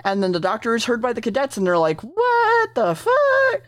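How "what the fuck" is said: very high, drawn-out disbelief; mock astonishment. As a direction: stretch each word high, hamming up the shock. Acting the startled cadets.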